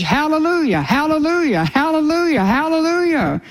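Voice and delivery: high ringing praise, each falling; exultant hallelujahs. Real, not acted. Repeating heaven's fourfold hallelujah.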